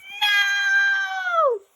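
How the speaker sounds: high shrieking "No!"; terrified scream. Acted. Boy screaming at the ghost bride. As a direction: scream it in horror.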